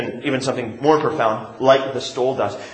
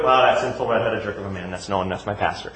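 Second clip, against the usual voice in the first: mock dismissive; 7 semitones lower, 4 dB quieter than usual. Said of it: "You don't have to say it like that".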